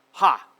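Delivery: loud scornful bark of a laugh; scornful queen's ha. Acted. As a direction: spit out a sharp ha. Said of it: "said the queen, speaking to herself".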